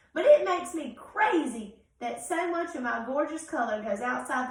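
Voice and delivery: loud, exasperated purple crayon; a neat freak about to lose it; fussy crayon. Acted. Reads Purple Crayon's letter.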